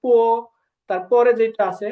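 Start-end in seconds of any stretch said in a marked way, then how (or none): 0.0-0.2 teacher says পো as Chinese bo, unaspirated b; pinyin bo. Shown as a demonstration. Teacher to students, pinyin sound demo. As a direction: clear demo of পো for Bengali learners. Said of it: "এটা হচ্ছে হবে পো".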